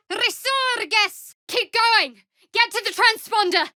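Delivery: high, loud rallying yells; urgent high war cries; battle shout. Acted. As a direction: shout orders across the battlefield.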